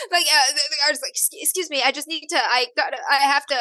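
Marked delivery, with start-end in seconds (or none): 1.1-3.6 higher, halting, flustered attempt; flustered excuse. Blocked artist trying to get past, flustered excuse. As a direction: stammer your way politely past someone.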